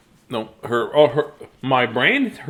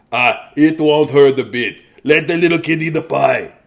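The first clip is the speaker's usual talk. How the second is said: louder, odd-timbred worker; gruff worker voice; 3 semitones higher, 8 dB louder than usual. Acted. Construction worker tells the judge to let the kid eat, loud. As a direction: boom it out like a burly builder. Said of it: "The construction worker said".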